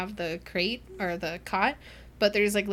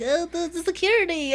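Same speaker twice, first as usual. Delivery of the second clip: flustered stammer; 9 semitones higher, 5 dB louder than usual. Retold or imitated. Flustered security head stammering.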